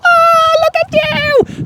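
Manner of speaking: very high, slow cooing gush; mock visitor gush. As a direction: coo at the dog like a gushing visitor. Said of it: "they're like".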